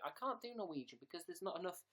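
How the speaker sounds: ordinary talk, nothing marked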